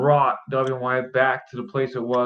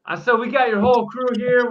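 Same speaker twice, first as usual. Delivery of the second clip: louder, higher repeat for a bad line; 8 semitones higher, 4 dB louder than usual. Real, not acted. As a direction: say it again louder so he hears. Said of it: "I can barely hear you".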